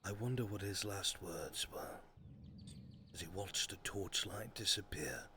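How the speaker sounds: low, soft, menacing whisper of guilt; hushed accusing narration. Acted. GM whispering to the guilty character, low and accusing. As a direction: murmur it like a haunting conscience.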